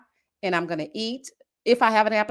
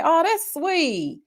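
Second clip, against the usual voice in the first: tender; 7 semitones higher, much slower than usual. Real, not acted. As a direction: say it tenderly, drawn out. Touched by reader's story.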